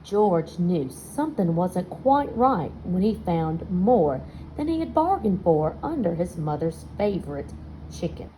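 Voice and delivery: ordinary delivery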